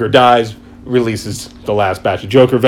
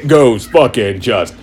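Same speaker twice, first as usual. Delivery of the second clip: usual speaking voice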